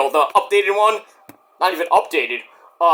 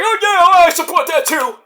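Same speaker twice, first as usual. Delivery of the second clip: mocking high voice; 11 semitones higher, 4 dB louder than usual. Mocking Kamala Harris.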